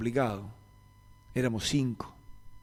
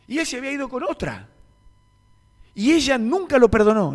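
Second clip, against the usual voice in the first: much higher, louder impassioned sermon; fiery preaching; 8 semitones higher, 9 dB louder than usual. Acted. Pastor exhorts the congregation to forgive, in fiery preaching. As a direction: preach it with full force.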